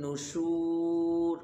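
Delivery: very slow, drawn-out নুশুর; chanted Arabic drill; slow recitation. Shown as a demonstration. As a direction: stretch নুশুর. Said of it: "প্রত্যেকটা উচ্চারণ আমি যেমনে উচ্চারণ করি".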